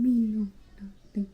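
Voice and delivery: lightly done whispered broken-English denial; Custis Felix impression. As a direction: whisper "Me know nothing" like a scared witness. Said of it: "Like how Custis Felix sound".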